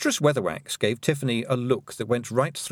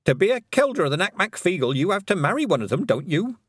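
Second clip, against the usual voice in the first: innocent question; 8 semitones higher, 3 dB louder than usual. Acted. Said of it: "said Missus Ogg innocently".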